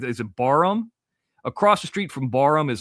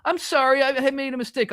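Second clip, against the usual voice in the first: mock bad actress line; 11 semitones higher than usual. Mimics bad actress delivering line.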